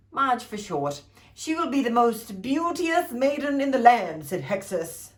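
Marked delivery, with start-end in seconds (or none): 1.3-4.3 a hint of a grand fairy; lightly voiced fairy godmother bestowing a gift; grand fairy blessing. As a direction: proclaim it like a gift.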